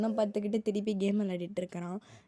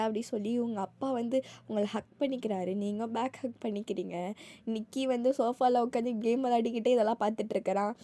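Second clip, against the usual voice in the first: ordinary delivery, nothing marked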